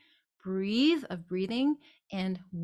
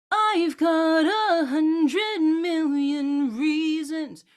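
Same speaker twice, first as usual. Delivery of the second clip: slow, intense but inward; intensify it internally; sung with inner intensity; internal energy; 6 semitones higher, 6 dB louder, much slower than usual. Shown as a demonstration. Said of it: "an internal energy".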